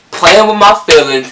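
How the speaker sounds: loud, high-pitched outraged comeback; indignant retort. Retold or imitated. Girl confronting the boy who toyed with her, indignant.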